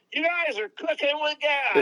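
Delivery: high-pitched imitation of someone yelling; mock yell. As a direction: call it out high, without real volume. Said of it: "he'd yell at us".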